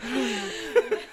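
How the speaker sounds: slow, high, crying with laughter; laughing hard. Real, not acted. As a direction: choke it out through laughter. Mocking the photo, cracking up.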